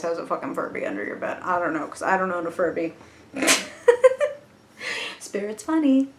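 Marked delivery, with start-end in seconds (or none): 3.3-4.4 sudden high laugh, half squeal; amused squeal of a laugh. Real, not acted. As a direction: burst into a high giggle. Laughs at her own joke.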